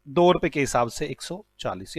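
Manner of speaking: ordinary delivery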